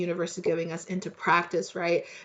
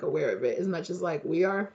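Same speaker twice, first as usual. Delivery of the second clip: ordinary delivery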